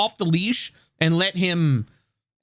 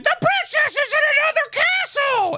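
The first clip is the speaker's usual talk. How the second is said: much higher, tiny mushroom-creature squeak; squeaky Toad voice. Retold or imitated. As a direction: pitch it way up like a little cartoon Toad. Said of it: "it's not too high pitched".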